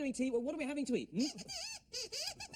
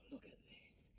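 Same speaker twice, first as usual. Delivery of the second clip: hushed whisper of discovery; 4 semitones higher, 19 dB quieter than usual. Real, not acted. Host lifts the lid on his own tea, whispering. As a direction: breathe it out, no voice at all.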